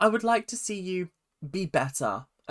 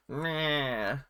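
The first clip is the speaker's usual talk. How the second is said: a lightly voiced, slightly off impression of a stern dance teacher; a half-hearted go at the dance teacher, trailing off in a dismissive groan; Abby impression; 5 dB quieter than usual. Mocking the stern dance teacher, a lightly voiced dismissive groan. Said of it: "That was not my Abby voice".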